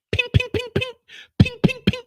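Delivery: very high, sharp ping sounds; high pinging gunfire noises; silenced gunshots. Retold or imitated. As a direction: mimic a silencer firing. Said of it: "That's the sign of the silencer".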